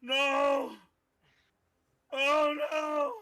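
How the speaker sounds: high loud terrified no; panicked scream. Acted. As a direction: scream in panic as you bolt. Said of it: "his eyes get wide".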